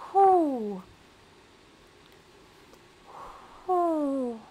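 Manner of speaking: high, falling "whew", twice; whew of relief. Real, not acted. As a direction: blow out the strain after heavy voice work. Catches breath after aggressive voice.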